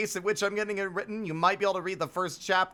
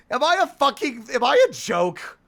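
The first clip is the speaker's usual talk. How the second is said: higher, louder, in hammed-up despair; mock outburst; 8 semitones higher, 7 dB louder than usual. Streamer rants to chat about being a joke, hammed-up and loud. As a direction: cry it out like a dramatic wreck.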